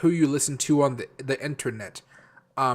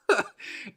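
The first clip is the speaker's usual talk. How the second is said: laugh; 9 semitones higher, 3 dB quieter than usual. Real, not acted. Laughing at own impression.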